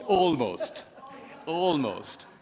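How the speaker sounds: ALMOST punched high and loud, falling away; "almost" as a loud, falling, humorous qualifier; emphatic jokey caveat. Real, not acted. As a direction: hit "almost" high, then let it drop. Joking self-correction after laughter.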